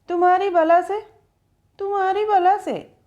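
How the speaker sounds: mock her pouting words; mocking imitation. A narrator's reading. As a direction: driver mimicking the girl's sulky retort. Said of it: "उसने इतरा कर नकल उतारी".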